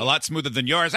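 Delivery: ordinary talk, nothing marked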